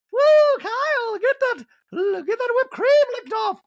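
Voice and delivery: very high, loud party whoop; high squealing cheer at the stage. Retold or imitated. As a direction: shriek it like a wild fan cheering. Dorit cheering Kyle on.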